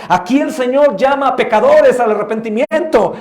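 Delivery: a pastor calling sinners to repentance, preaching loud; full-force preaching. Acted. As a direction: proclaim it like a fiery preacher.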